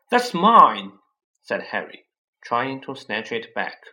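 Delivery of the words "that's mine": loud, higher protest; indignant cry. Acted. Harry protests to Uncle Vernon, loud and high. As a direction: grab back what's yours out loud. Harry claims his letter.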